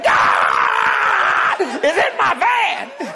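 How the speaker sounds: slow, drawn-out, high and loud cry of joy; ecstatic slow shout. Retold or imitated. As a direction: shout it like the Spirit just hit you. Retells shout, very slow, laughing.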